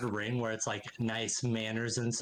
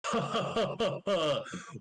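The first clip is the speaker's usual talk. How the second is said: high, rolling belly laugh; big welcoming laugh in character; hearty character laugh; 8 semitones higher than usual. Acted. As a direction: laugh heartily before greeting the guests. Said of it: "and he goes".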